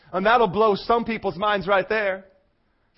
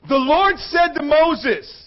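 slow, raised, weighty reading; scripture proclamation; 4 semitones higher, much slower than usual. Real, not acted. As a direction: proclaim it slowly to the whole hall. Reads scripture at full force.

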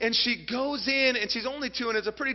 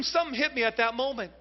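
normal talking voice

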